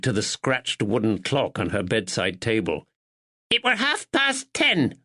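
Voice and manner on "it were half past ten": put-on sharp old woman. A narrator's reading. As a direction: snap it out like a sharp sparrow.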